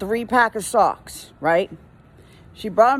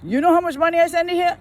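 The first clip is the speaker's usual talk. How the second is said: fast, higher bragging customer; mock entitled customer; 7 semitones higher, faster than usual. Worker imitating customers who brag about spending, mocking. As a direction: rattle it off like a snob.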